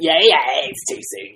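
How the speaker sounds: loud, hammed-up protest at the comment; mock outrage. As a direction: bellow it like a fake scandal.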